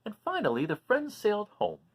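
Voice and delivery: lively, high storybook voice; animated read-aloud for little listeners; reading to children. A narrator's reading. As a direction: read it out like a picture book for kids. Reading picture book to children.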